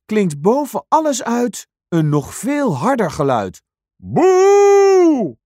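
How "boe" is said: very high, very loud booing moo. Acted. Cow drowns out everyone with a loud moo. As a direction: belt out a huge cow moo.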